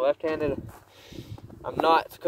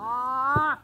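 startled yelp; 11 semitones higher, 4 dB louder than usual. Real, not acted. Reacting to a shot.

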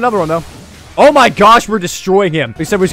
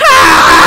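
loud, grating imitated screech; most annoying noise; 13 semitones lower, 8 dB louder than usual. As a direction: blast out an obnoxious noise. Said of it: "the most annoying noise in the world".

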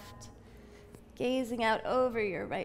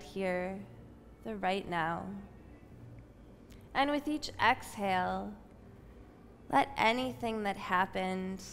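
soft, unhurried yoga teacher voice; guided breathing meditation; 5 dB quieter than usual. Acted. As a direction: ease the class into stillness with calm breath cues. Leads breathing meditation on mat.

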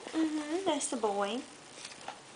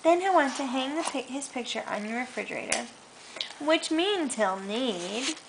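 put-on reading to a child; 6 semitones lower, 3 dB quieter than usual. Mother reading a bedtime book to her toddler, gentle storytelling. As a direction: softly read picture-book storytelling. Reads picture book aloud.

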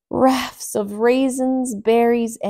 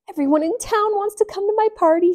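much higher, fast and thrilled; 11 semitones higher, 5 dB louder, faster than usual. A narrator's reading. Emmy thrilled about her party, high and fast. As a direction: gush with delight. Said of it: "said Emmy".

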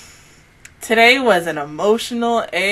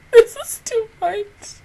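high, slow, choked-up; tearful overwhelm; 11 semitones higher than usual. Real, not acted. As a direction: let it crack with tears. Overwhelmed by emotion.

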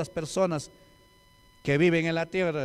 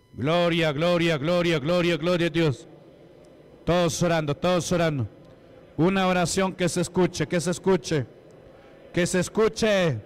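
loud, insistent preaching with rapid repeated phrases; rally the room into prayer at full force; fervent prayer call; 4 dB louder than usual. Real, not acted. Preacher calling the congregation to pray aloud, loud and fervent.

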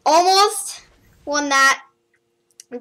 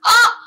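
loud, high startled shriek; a sharp pained yell; sudden yelp; 10 semitones higher, 10 dB louder than usual. Real, not acted. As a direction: cry out as if something hit you. Sudden cry as the recording ends.

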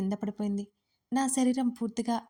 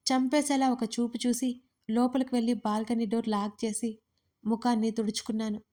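usual speaking voice

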